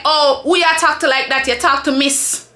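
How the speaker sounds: imitating a coworker's scolding in patois; mock-indignant patois quote; Jamaican patois. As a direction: confront them in Jamaican patois. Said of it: "I'ma say this in patois".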